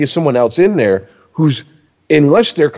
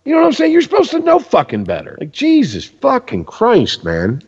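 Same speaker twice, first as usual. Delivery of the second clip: incredulous rant; 10 semitones higher than usual. Real, not acted. Ranting continues.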